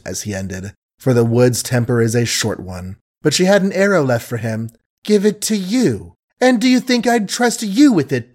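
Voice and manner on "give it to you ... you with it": high-pitched, incredulous indignation; scornful retort. A narrator's reading. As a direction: throw his request back at him, voice raised in pitch.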